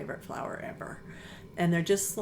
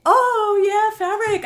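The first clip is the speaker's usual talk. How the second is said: much higher and louder, bursting with delight; light up at the mention of fabric; excited burst; 13 dB louder, slower than usual. Real, not acted. Guest lighting up when asked about fabric design, thrilled.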